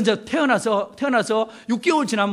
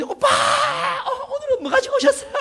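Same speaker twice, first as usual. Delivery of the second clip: squealing, much higher cry of a giddy hen; excited hen voice. Retold or imitated. Hens greeting their keeper at the coop: high excited squeal. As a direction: shriek it like a hen thrilled to see him. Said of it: "이러면서 막 난리 법석을 떨면서 저를 반겨합니다".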